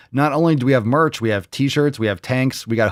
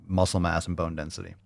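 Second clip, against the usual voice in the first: ordinary talk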